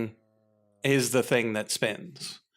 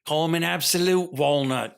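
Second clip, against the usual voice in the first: higher, hammed-up Scottish; Scottish accent; 5 semitones higher, 5 dB louder than usual. Shown as a demonstration. Coaching a friend to sound more Scottish, Scottish accent. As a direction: lay the Scottish accent on thicker. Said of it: "you gotta make it more Scottish".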